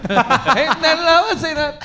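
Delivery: high, giggly denial; laughing. Real, not acted. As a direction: laugh through the no.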